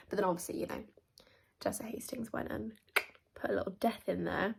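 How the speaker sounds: soft conspiratorial whisper. Real, not acted. Reader hinting at a death to viewers, whispered. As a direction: hush it like a spoiler. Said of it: "unvoiced whisper".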